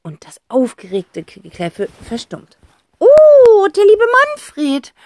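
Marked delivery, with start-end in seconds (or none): 3.0-4.9 very high, loud, sugary sing-song; fake-sweet fluting. A narrator's reading. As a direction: flute it sweetly, faking delight. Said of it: "flötet Tante Rotz".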